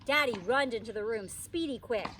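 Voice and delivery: ordinary delivery, nothing marked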